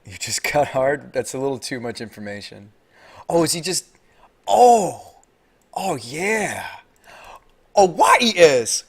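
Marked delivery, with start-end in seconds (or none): 3.3-8.8 high, loud, gleeful outburst; rising excited exclamations; excited realisation. Real, not acted. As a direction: burst out as the play dawns on you. Excited reaction to opponent's play.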